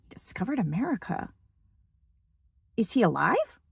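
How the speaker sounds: mock-baffled, innocent questioning; feigned ignorance. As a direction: play dumb to prank the guide, quietly. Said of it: "totally pranking him".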